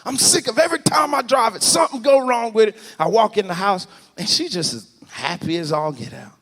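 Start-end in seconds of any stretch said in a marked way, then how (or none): 0.0-2.8 retold exasperated complaint, raised pitch; mad. Driver slamming the truck door, mad retold complaint. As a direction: grumble it louder and higher as you go. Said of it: "I'm getting mad, man. I slammed that door".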